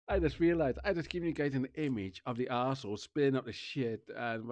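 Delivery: normal talking voice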